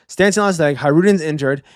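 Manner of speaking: ordinary talk, nothing marked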